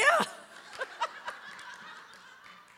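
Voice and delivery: long breathless laugh; real laughter; high airy laugh. Real, not acted. Laughing at her own story.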